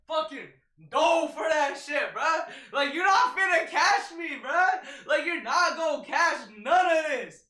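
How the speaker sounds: high excited yelling, taunting the driver; hyped taunting shout. Retold or imitated. To the swerving driver, a high excited taunt. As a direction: yell it like dodging a car.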